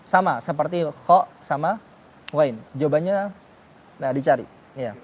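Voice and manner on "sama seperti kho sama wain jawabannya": ordinary talk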